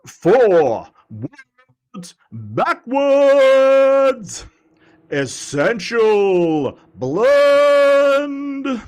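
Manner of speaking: loud, high, very long-held syllables; full-throttle announcer roll call. Pretend. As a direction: hold the last word of each name like a ring announcer. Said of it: "announcer voice going".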